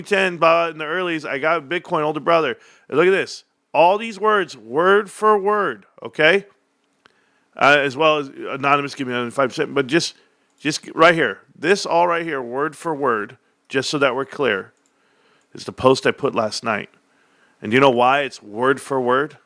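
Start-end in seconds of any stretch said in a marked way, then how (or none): none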